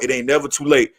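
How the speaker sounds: ordinary talk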